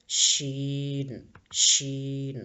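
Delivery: شین held long, slowly, the ش stretched; drawn-out letter name. Shown as a demonstration. Teacher to pupils, sounding out شین slowly. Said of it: "اس کو ہم شین کہتے ہیں".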